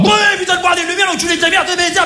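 high, yelled crude swearing; rough Rouen buddy. Retold or imitated. Comedian imitating his crude school pal Léon shouting oaths. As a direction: bellow it like a rowdy old schoolmate from Normandy. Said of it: "c'est plutôt du genre".

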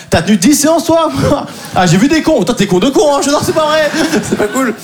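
ordinary talk

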